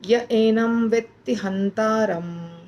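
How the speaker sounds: य एनम व्यक्ति हंतारम spoken slowly, each word laid out; slow model recitation. Shown as a demonstration. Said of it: "ऐसे बोलना चाहिए".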